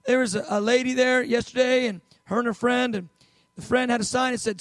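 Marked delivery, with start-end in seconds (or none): none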